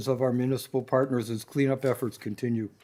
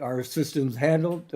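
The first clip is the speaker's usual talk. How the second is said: normal talking voice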